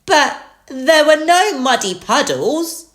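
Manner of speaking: high, loud little piglet voice; Peppa. A narrator's reading. Peppa tells Mummy Pig the drawback, high-voiced. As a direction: add the complaint in a child's pitch. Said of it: "Peppa yawned".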